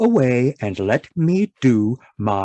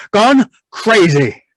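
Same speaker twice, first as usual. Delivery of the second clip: alarmed repeat; 8 semitones higher, 6 dB louder, slower than usual. Acted. Husband repeats "gone crazy" in alarm.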